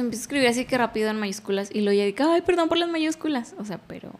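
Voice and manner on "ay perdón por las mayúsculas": high-pitched mock apology; retold apology. Retold apology for typing capitals. (disculpa imitada en tono agudo)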